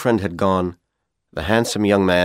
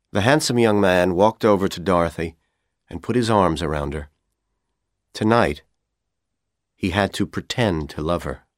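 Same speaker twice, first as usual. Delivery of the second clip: usual speaking voice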